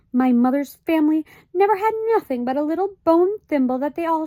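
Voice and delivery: high, anxious mother's voice; fussy high-pitched mother; Homily, fretful mother. A narrator's reading. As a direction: voice a worried little mother. Homily's voice in the story.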